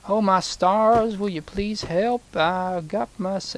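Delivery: high, slow mock southern plea for help; hammed-up helpless farmer; pleading farmer. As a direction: play a desperate farmer begging. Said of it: "a guy around here being like".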